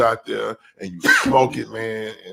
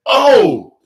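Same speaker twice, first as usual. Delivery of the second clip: imitated delight; 13 semitones higher, slower than usual. Quoting Jordan praising the smoked turkey, excited mock rave. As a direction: gush like the taste blew him away. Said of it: "and said".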